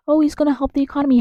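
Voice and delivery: high, fast mocking imitation; mock quoting. Mimicking a Trump supporter's argument, mock quoting. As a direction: parrot their naive line quickly and high.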